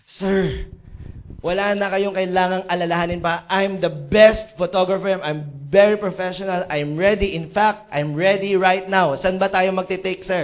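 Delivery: loud, emphatic self-confidence; hammed-up cocky assurance, punched out; boastful photographer. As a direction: boast to the boss with total certainty.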